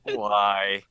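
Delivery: low, slow groan of despair; groaning dismay. Retold or imitated. Doorman reacting to the butt pirate joke, groaning. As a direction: groan it out slowly and low, mock-anguished. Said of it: "face palming and groaning, just like".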